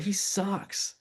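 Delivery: put-on sulky teen verdict; flat dismissal. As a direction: brush the brother off. Retold dismissing his brother.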